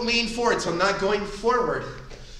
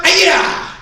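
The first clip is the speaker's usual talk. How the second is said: sharp high martial-arts yell; kiai shout; 10 semitones higher, 9 dB louder than usual. Shown as a demonstration. Instructor leading class drill, loud kiai shout. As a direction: shout the strike out from the gut.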